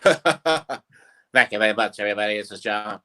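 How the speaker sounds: slow Liverpool cartoon Beatle thanking the crowd; cartoon [name]. Host asks for the Beatle cartoons, slow Liverpool John voice. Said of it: "especially the Beatle cartoons".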